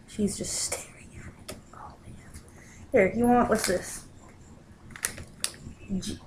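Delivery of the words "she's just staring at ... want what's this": ordinary delivery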